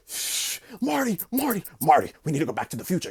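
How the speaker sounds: high, fast frantic movie voice; Doc Brown impression. Doc from the movie urging Marty, high frantic rush. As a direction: hiss then rush out the warning. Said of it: "son just came out the V".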